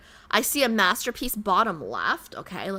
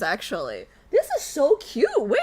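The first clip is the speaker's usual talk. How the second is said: ordinary talk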